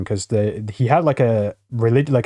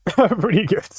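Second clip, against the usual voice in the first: laughing; 8 semitones higher, slower than usual. Real, not acted. Laughing repeat.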